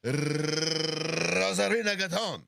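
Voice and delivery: slow Spanish name with endless rolled R; exaggerated Spanish accent. Host shows what American news allows, exaggerated Spanish accent. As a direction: trill and stretch it like a Spanish announcer. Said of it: "you can do that".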